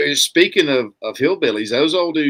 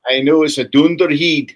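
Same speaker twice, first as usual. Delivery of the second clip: high, loud Scottish accent; 5 semitones higher, 7 dB louder than usual. Shown as a demonstration. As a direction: put on a bold Scottish brogue. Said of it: "say dunderhead in Scottish accent for me".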